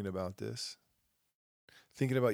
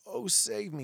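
high sneering little cry; mock the helpless attitude, pitched up; host mocking the save-me attitude, high and sneering; mocking plea; 9 semitones higher than usual. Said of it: "this attitude of".

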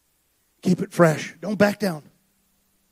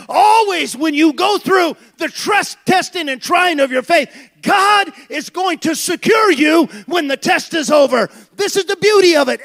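louder, higher preacher's build; preaching at full force; 5 semitones higher, 5 dB louder than usual. Acted. Preacher rallying the church that God wasn't done with Peter, loud and rising. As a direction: rouse the congregation with mounting fervor.

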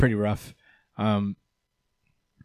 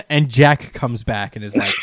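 ordinary talk

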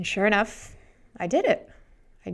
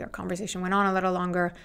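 ordinary delivery

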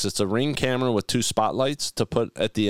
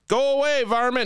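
mock shooing call; 11 semitones higher than usual. Host pretending to warn a varmint off his camera, high holler. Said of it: "I can be like".

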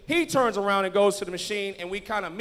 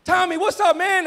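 high, loud excited hail; big imitated greeting, pitched way up; calling out a greeting; 11 semitones higher, 13 dB louder, slower than usual. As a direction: shout a welcome across the room.

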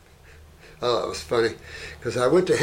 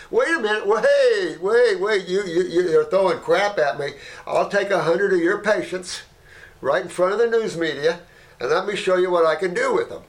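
calling after him; 5 semitones higher than usual. Retold or imitated. Challenging the angry doctor to come back, raised voice. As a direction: stop him as he walks off.

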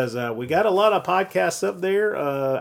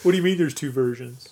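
mock dumb listener. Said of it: "We hope you all talk like that".

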